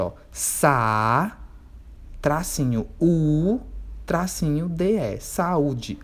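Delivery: saúde split into sa, u, de with pauses; syllable by syllable. Shown as a demonstration. Teacher splits saúde into syllables for pupils, carefully. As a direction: spell out sa - u - de slowly, then join it. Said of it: "Por exemplo".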